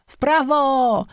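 high, drawn-out yell; shrieked command from a rival creature. A narrator's reading. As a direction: yell it high, stretching the word. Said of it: "wrzeszczał drugi".

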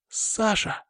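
calling out. Acted. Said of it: "крикнула она".

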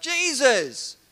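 acted high, loud shout; calling out. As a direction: yell to be heard over a noisy crowd. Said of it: "so Bartimaeus calls out".

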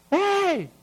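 pregón agudo y alargado, sin fuerza; grito de tortillero. Shown as a demonstration. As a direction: imita el llamado de un tortillero. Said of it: "tortillero, ¿no?". (grito callejero de tortillero)

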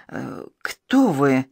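halting, slowed words squeezed out in shock; forced out. Acted. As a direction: she can barely get the words out. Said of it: "выдавила из себя". (выдавливает слова, запинаясь от страха)

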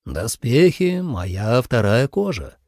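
slow, self-important declaration; pompous. A narrator's reading. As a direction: pronounce it grandly and slowly. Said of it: "важно изрёк Ли Цзэ".

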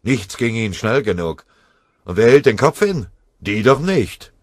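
mounting fury, ending loud and high; seethe, then burst out; angry. Acted. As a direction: sergeant fuming at the staff behind the lines, angry. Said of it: "dachte Scharf wütend".

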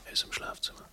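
urgent whisper. Acted. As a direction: keep it under your breath. Watching for intruder at night.

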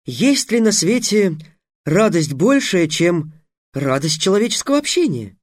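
a hint of an oily flatterer; flowery young mage. Trix asks for introductions.

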